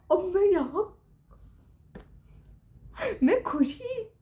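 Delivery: overwhelmed with happiness, near tears; tearful joy. Real, not acted. Overjoyed at her mark.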